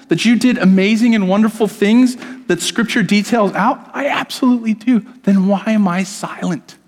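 high, searching plea to God, rising on each question; retold anguished prayer. Preacher reliving his prayer to God, high pleading.

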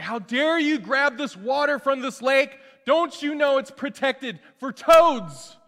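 high, loud, slow outrage; indignant scolding. Retold or imitated. Official scolding firemen over toad lake water, outraged. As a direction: scold them like a petty official.

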